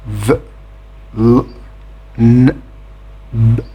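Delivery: v, l, n, b hummed as bare voiced consonants; isolated voiced sounds. Shown as a demonstration. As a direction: sound out v, l, n, b one at a time, pausing between. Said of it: "end in voiced sounds".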